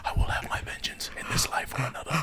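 fast vengeful whisper. Acted. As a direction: hiss the vow under your breath. Acting a vengeful vow.

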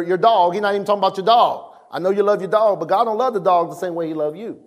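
ordinary delivery

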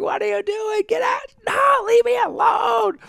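high-pitched, panicked yell, re-enacted; screaming bloody murder. Pinned little brother to older brother dangling spit over his face, screaming. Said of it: "I'm, like, screaming bloody murder".

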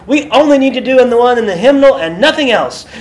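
imitating an indignant complaint, high and loud; upset old lady. Old lady demanding only the hymnal version, exaggerated upset. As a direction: lay down the law in mock outrage. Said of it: "she was just getting so upset".

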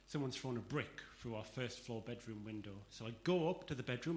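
ordinary talk

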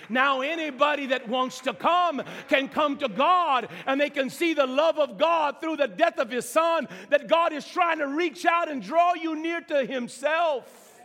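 high, loud, driving sermon voice; full-force preaching. Real, not acted. Preacher urging the congregation that Jesus rescued them, full-force preaching. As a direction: preach it hard, drawing out the big phrases.

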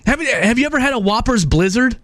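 ordinary talk

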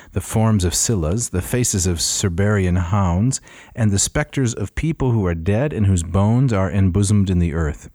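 loud, measured reading voice; formal reading. Acted. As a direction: declaim it like an old text. Reads Smith translation aloud.

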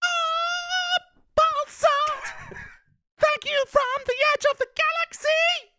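extremely high, wailing rock falsetto; piercing half-sung falsetto. Acted. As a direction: shriek it like a prog-rock singer. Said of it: "can I get some [name]?".

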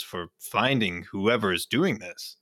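normal talking voice

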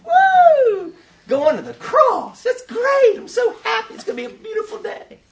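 sing-song falsetto glee, absurdly high; mock delight. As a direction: gush with hammed-up happiness, squealing the woo. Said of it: "He wasn't going".

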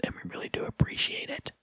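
soft whispered thanks; whispering. Real, not acted. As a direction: whisper it sincerely.

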